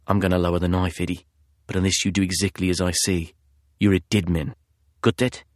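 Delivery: put-on low, soft husky British accent; cold British threat, husky and quiet. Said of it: "a man's husky voice".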